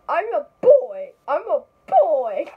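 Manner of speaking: put-on loud burst on the word boy; emphatic protest. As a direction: shout it out as a stubborn correction. Character protesting being called girl.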